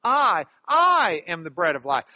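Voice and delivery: "I" repeated loud and stretched, the rest falling; emphasis on I. Shown as a demonstration. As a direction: punch both "I"s, then come down.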